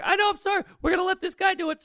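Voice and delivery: very high, tearful apology; put-on high, pleading voice; mock weeping. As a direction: imitate a weeping producer apologising. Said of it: "And she's weeping".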